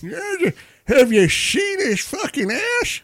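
high, slow, hammed-up old man voice; silly high caricature of a grumpy old man; put-on old man accent. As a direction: leer like a cartoon old coot, drawn out and high. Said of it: "but in that accent".

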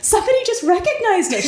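high, loud, fast and thrilled; excited squeal. Retold or imitated. Retelling her friend's excited phone call, thrilled. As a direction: gush the news like it just happened.

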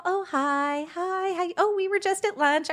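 mock sweet housewives. Mocking the women who snubbed her, high sugary greeting. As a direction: coo the hi's like fake-friendly ladies.

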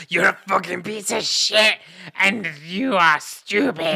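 hammed-up furious retort; imitating an angry partner; mock angry rant. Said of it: "you're like".

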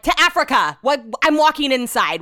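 loud, high exasperated retort; sarcastic comeback. Retold or imitated. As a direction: fire back with mock outrage. Sarcastic snap back in retold spat.

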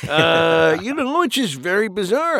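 The Dude from The Big Lebowski, easy and unhurried; Jeff Bridges impression. Shown as a demonstration. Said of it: "I do a pretty good Jeff Bridges".

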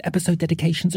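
usual speaking voice

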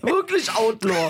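high, slow, laughing tease; slow, high-pitched teasing through laughter. Real, not acted. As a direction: drag it out while laughing at him.

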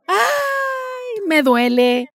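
high put-on wince; girlfriend's mock pained cry. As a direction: whimper it like a shy girlfriend. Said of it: "La novia dice".

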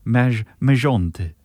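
French "Dear" with a rolled R; French accent, rolled R. Acted. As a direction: open the letter in French accent, rolling the R. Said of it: "I think if you roll the R".

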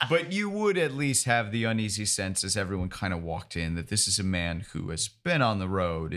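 ordinary talk

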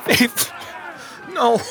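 high anguished plea; tearful, high-pitched wail; weeping. A narrator's reading. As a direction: cry out for a friend through tears.

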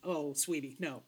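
gently patronize the blackmailer; condescending sweetness. Retold or imitated. Lucius dismissing the blackmailer, soft patronizing sweetness. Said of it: "Lucius just being like".

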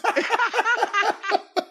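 cackling at the insult; delighted shriek of laughter; high laugh. Real, not acted. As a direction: burst of high-pitched laughter. Cackle after reading insult.

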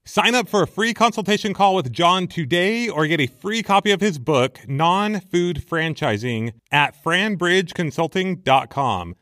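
upbeat sponsor ad read. Acted. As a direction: read it like a podcast ad.

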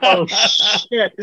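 normal talking voice

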